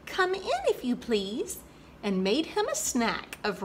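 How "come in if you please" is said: higher, timid little mouse voice; meek squeak. Acted. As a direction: squeak it meekly like a shy mouse. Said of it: "She squeaked very meekly".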